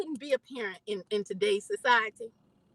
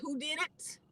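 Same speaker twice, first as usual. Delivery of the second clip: rising to a high, loud cry; mother demanding who hurt her son; frantic demand; 5 semitones higher, 4 dB louder than usual. Retold or imitated. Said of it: "and I said".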